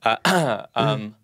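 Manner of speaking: Israeli-accented imitation of Noah; impression of Noah. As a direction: play Noah with a heavy accent. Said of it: "best impression of me and Matan".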